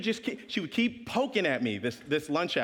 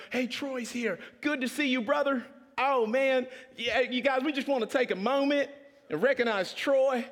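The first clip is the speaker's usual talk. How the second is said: much higher, upbeat host voice; hyped emcee; 9 semitones higher than usual. Retold or imitated. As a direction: announce like a pumped campus pastor. Event host voiced enthusiastically.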